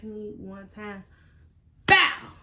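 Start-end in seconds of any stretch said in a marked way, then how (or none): 1.9-2.3 a sharp, loud "bow" like a smack landing; imitated slap sound. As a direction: smack the word out like a hit.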